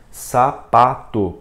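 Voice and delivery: SAPATO slowly split into three syllables, SA, PA, TO. Shown as a demonstration. Teacher gives a three-syllable example, syllable by syllable. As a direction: say Sapato with a gap after each syllable. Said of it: "palavras com três sílabas".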